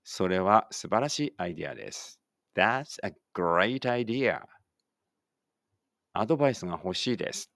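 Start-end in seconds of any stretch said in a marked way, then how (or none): 2.5-4.4 slow, carefully spaced English for the class to repeat; slow model reading. Shown as a demonstration. Teacher models English for pupils reading aloud together, slow and clear. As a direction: say each word slowly and clearly, like a teacher modeling. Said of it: "一緒に声出してください".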